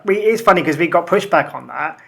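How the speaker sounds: usual speaking voice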